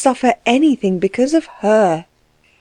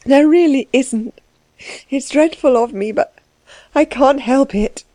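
higher, halting, tearful voice; tearful, helpless confession; weeping; 5 semitones higher than usual. Acted. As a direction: weep it out in short broken phrases. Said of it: "she wept".

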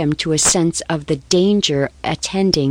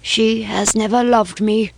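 passionate confession; 4 semitones higher than usual. Acted. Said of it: "the passion of confession long suppressed".

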